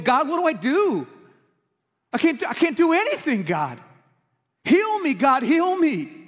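high, loud pleading cry to God; retold desperate crying out. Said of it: "I'm crying out to God".

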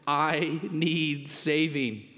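slow punchline. Acted. Punchline repeat.

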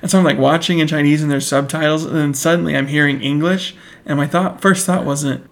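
usual speaking voice

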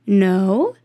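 ordinary delivery

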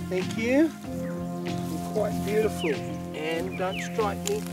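hushed coaxing to a snake; excited wildlife host whisper. Real, not acted. Softly addressing the python.

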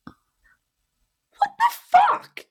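squeaky, drawn-out falsetto disbelief; retold high-pitched outrage; incredulous outburst. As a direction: stretch it out in a very high, shocked squeal. Retelling her outraged reaction.

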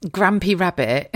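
normal talking voice

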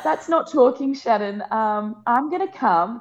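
a hint of a knowing midwife. Midwife voiced in retold phone call.

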